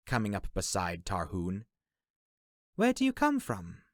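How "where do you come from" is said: put-on high young woman's voice; Fee. A narrator's reading. Fee asks the newcomer elf where he is from, high voice. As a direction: ask as Fee, pitched up.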